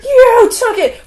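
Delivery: shrieked very high and loud, cracking into a laugh; overdone screech, laughing through it; mock scream.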